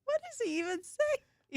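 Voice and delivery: laughing. Real, not acted. Laughing after Cajun clip.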